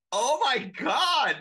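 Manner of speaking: high, loud, drawn-out dismay; mock-outraged exclamation, stretched out; appalled outcry. Acting out shock at Gwen's line.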